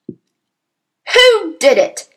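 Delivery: high, loud, drawn-out furious demand; enraged bellow; roaring. A narrator's reading. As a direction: roar the question slowly at the whole room. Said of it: "she roared".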